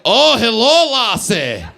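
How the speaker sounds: hammed-up slow Scottish brogue; mock Scottish pickup voice; Scottish accent. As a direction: do a drawn-out, boisterous Scottish dude. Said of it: "How does a Scottish dude walk into a bar".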